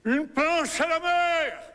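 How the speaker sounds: high, loud, drawn-out alarm call; crying out. Acted. Artist floating as a plank crying out, high long shout. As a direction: shout it slowly as if overboard. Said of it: "Il a envie de crier".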